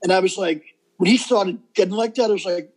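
ordinary delivery

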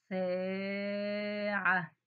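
ساعة drawn out with the ا held long; very slow stretched word. Shown as a demonstration. Teacher to children, stretching ساعة slowly. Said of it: "حرف السين ومديته".

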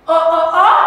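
usual speaking voice

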